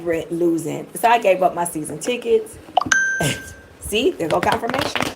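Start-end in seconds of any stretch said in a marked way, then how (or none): 2.8-3.4 quick airy laugh, way up high; a tiny squeal of a laugh; squeaky laugh. Real, not acted. Laughs about losing Detroit.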